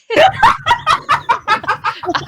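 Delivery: high, loud burst of laughter; loud laughing fit. Real, not acted. Player teased about flirting, loud high laughter.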